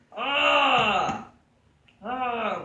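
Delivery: high, loud hammed-up cry of pain, then fading; mock pained cry. Instructor showing the wrong choke finish to his class, mock pained cry. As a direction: let out a big fake yelp.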